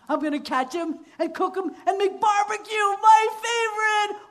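excited hunter. Acted. Hunter gloating to himself about cooking the pig. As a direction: gush about barbecue.

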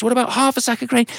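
high, eager thankful voice; a family delighted to reward the ant; high excited offer; grateful family. Acted. Family bargains.

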